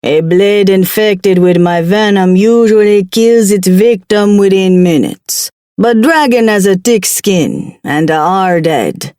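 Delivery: lightly voiced Jamaican accent. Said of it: "He spoke with a thick Jamaican accent".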